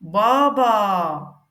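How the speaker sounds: "Baba" said slowly and clearly; model word. Shown as a demonstration. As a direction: pronounce "Baba" for learners to copy. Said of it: "je vais venir prononcer chaque lettre".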